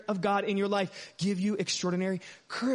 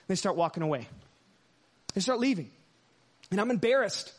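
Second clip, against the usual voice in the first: ordinary talk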